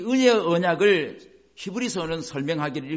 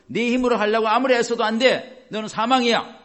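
raised, higher voice of the accusing law; mock stern condemnation, louder and higher; stern scolding law; 6 semitones higher, 5 dB louder than usual. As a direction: scold the listener as the harsh law would. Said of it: "이러는 거예요".

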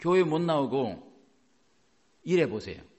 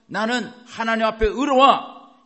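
loud, high, slow declaration; emphatic preacher's proclamation; bold confession; 7 semitones higher, 9 dB louder than usual. Shown as a demonstration. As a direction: declare it with full conviction. Models a bold confession of faith.

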